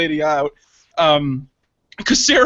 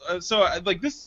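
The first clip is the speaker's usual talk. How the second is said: usual speaking voice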